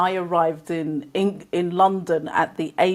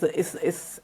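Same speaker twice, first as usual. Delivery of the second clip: usual speaking voice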